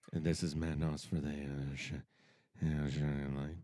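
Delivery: very low, soft monotone drone; malfunctioning robot. Pretend. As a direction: drone low like a machine winding down. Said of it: "somebody reboot Matt".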